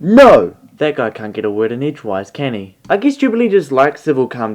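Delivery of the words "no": shouted, higher and louder; loud disappointed no. Acted. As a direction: yell out a disappointed no. Mock reaction to debate stopping.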